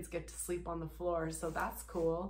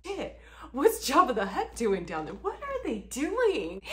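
loud, raised disbelief; imagined passenger staring down in loud disbelief; mock gawking; 5 semitones higher, 6 dB louder than usual. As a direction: gawk down at them, amazed.

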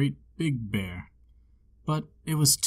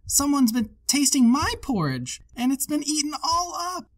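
put-on much higher, tiny baby bear squeak; little wee bear voice; 12 semitones higher than usual. A narrator's reading. Baby bear finds his porridge all gone, high tiny baby bear voice. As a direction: squeak it like the smallest cub. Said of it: "in his little wee voice".

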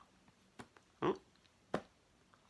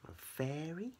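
usual speaking voice

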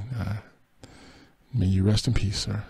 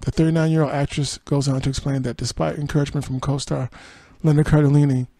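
steady article-reading voice; news read; 3 semitones higher, 4 dB louder than usual. Acted. Host reading Busy Phipps's allegation to viewers, news read. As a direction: read the report and its quotes evenly.

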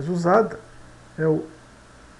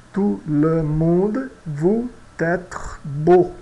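slow French example; much slower than usual. Shown as a demonstration. Teacher reading the example sentence slowly to students. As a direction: Tout le monde vous être beau said very slowly. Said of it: "Vamos ver o nosso exemplo".

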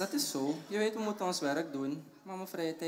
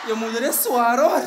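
high, loud complaint; raised voice under effort; strained complaint; 6 semitones higher, 14 dB louder than usual. Acted. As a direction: complain loudly while struggling with a heavy load. Struggling with the heavy mother.

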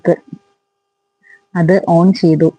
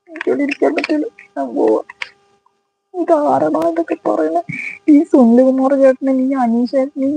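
high, whiny half-joking fright; higher, playful scared voice; 7 semitones higher than usual. Real, not acted. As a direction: pretend panic about being scared. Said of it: "എനിക്ക് പേടിയാ".

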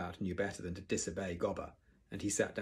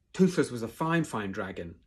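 holding back anger; 2 semitones higher, 7 dB louder than usual. A narrator's reading. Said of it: "quietly trying to keep his temper".

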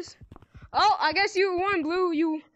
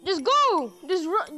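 ordinary delivery

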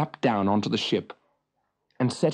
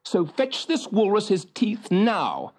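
stern; 8 semitones higher than usual. A narrator's reading. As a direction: warn them firmly. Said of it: "said Fricka sternly".

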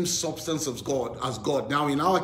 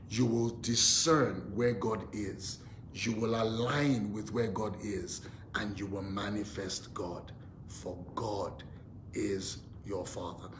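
hushed closing blessing over listeners; prayerful fade; 5 semitones lower, 7 dB quieter than usual. Real, not acted. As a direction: drop softer and lower, slowing. Preacher's prayerful declaration, fading.

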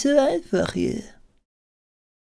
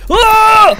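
high loud startled scream; scream of fright; 13 semitones higher, 9 dB louder than usual. Real, not acted. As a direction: shriek in sudden fright. Sudden scare in horror game.

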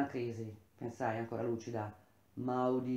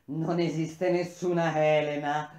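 loud, forceful declaration; shouted in his face; 3 semitones higher, 7 dB louder than usual. A narrator's reading. Seer confronts Eumelo in the palace, shouting. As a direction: throw it at him, louder. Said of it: "a lui gridai in faccia".

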